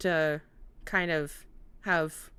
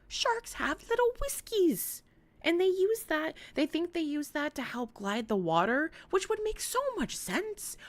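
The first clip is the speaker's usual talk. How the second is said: much higher, eager voice; excited; 12 semitones higher than usual. Real, not acted. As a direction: gush about sharks. Excited shark facts.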